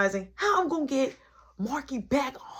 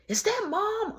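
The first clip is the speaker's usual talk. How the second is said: put-on lightly high little-kid voice; a hint of a little kid; small kid; 12 semitones higher than usual. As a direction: ask like a small child.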